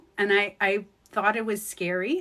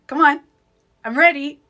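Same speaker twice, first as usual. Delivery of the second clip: higher and much louder, laughing into it; eager call; 5 semitones higher, 9 dB louder than usual. Real, not acted. Calling to spirits during meditation, eager and loud. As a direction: beckon the spirits like you can't wait.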